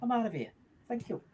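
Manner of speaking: soft, whispered joking goodbye; whispered sign-off. Pretend. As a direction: whisper it as a cheeky parting jab. Signing off, mock insult to viewers.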